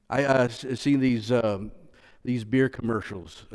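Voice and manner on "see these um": normal talking voice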